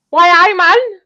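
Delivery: high, loud laughing Geordie echo; Geordie accent. Pretend. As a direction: squeal the Geordie line back. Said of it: "Was that a good go?".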